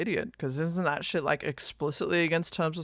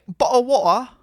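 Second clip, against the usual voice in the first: loud, higher mock-British call; mock British accent; 7 semitones higher, 10 dB louder than usual. Streamer showing chat how a Brit says it, exaggerated British accent. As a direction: ham up a British accent, dropping the Ts. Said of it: "If I was British, I'd say".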